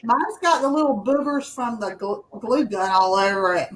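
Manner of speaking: ordinary talk, nothing marked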